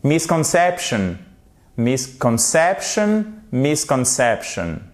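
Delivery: misconception slowly, beats on MIS and CEP; secondary stress. Shown as a demonstration. Teacher making the secondary accent audible, slow two-stress delivery. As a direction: draw out "misconception" so both stresses sound. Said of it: "Faut quand même le prononcer".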